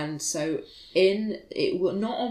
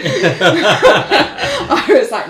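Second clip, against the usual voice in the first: loud laugh through the words; 4 semitones higher, 13 dB louder, much slower than usual. Real, not acted. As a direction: laugh while saying it. Laughing at own story.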